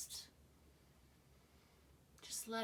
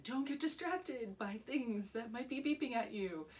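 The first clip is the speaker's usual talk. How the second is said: louder, higher, irritated snap at the noise; agitated; 4 semitones higher, 6 dB louder than usual. Retold or imitated. Retelling the meditation take ruined by beeping, louder and agitated. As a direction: let the annoyance leak out, raising the voice. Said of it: "got a little agitated, and I said".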